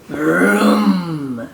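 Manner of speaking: drawn-out engine noise, mock sleepy; Mister Watson dreaming of a fast car, mock vroom. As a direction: make a dreamy car sound. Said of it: "said Mister Watson in his sleep".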